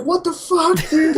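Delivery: very high, incredulous outburst; retold outraged protest. To the friend who says he was playing, outraged.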